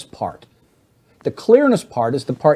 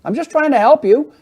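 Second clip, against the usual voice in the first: high, loud exasperated helper; acted yelling; 11 semitones higher, 5 dB louder than usual. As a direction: raise your voice at a spouse, insisting. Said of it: "Why are you yelling at me".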